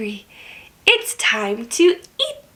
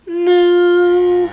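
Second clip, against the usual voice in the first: loud, long level moo; drawn-out playful cow call; 3 semitones higher, 5 dB louder than usual. Pretend. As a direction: moo out for children to copy.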